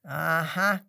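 low, loud grumbling aha; growled. A narrator's reading. Lion grumbling at the chatty penguin, growling. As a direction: grunt it sourly. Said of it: "knurrt der Löwe".

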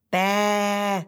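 sulky little mussel's bäh; grumpy. Acted. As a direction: spit it out like a grumpy child. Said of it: "antwortet die Miesmuschel miesmutig".

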